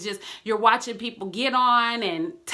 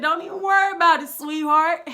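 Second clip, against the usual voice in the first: inner reassuring voice; 6 semitones higher, 4 dB louder than usual. Retold or imitated. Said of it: "that little thing in you, like".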